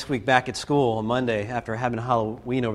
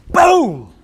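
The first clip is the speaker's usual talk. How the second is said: big shouted boom, up high; loud exclamation; 12 semitones higher, 10 dB louder than usual. Retold or imitated. Dramatic punchline of story.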